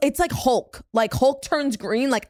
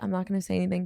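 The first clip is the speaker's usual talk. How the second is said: softer, lower restrained quote; restrained aside; 5 semitones lower, 7 dB quieter, much faster than usual. Retold or imitated. Friend quoting herself holding back in the bar, restrained.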